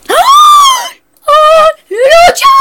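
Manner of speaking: very high, loud battle screams; desperate screams. Real, not acted. Gamer in a desperate fight, screaming. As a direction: scream all-out while fighting.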